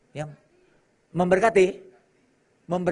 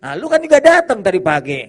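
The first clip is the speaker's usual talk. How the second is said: husband scolding; 4 semitones higher, 9 dB louder than usual. Retold or imitated. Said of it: "Suami berkata".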